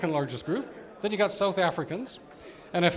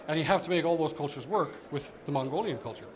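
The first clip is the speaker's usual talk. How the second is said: normal talking voice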